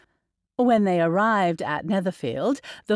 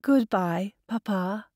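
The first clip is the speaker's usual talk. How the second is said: thickly. Acted. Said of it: "she said thickly".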